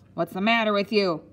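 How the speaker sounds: loud matron's shout. A narrator's reading. Matron confronts a boy staggering into her room, shouting. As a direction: fire the question out forcefully. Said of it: "the matron shouted".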